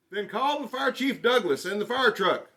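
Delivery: loud, high panicked shout; urgent shout for the farm hands; farmer shouting. Acted. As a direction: yell for help across the field. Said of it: "the farmer shouted".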